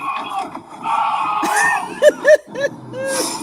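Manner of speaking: high, shrill burst of laughter; real high laughing shriek. Real, not acted. Laughing at empty camera moment.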